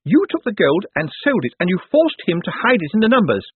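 high-pitched, emphatic accusing outburst; defiant indignation. A narrator's reading. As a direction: throw caution away and denounce them. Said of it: "decided she'd rather be scarlet than grey".

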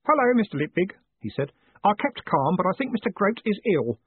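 put-on high, earnest boyish voice; a boy trying hard to keep calm; eager boy Stanley. A narrator's reading. Stanley reports the fire to Moist in a high boyish voice.

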